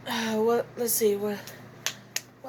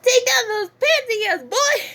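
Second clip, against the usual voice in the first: put-on much higher, brash crude girl; Miu Iruma voice; 10 semitones higher than usual. A brash inventor teasing a boy, high and crude. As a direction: go high and brazen. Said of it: "I kinda sound like this".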